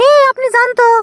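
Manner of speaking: high put-on woman's voice; wife's voice. Young wife shooing her husband out, in a high put-on voice. Man voicing his wife.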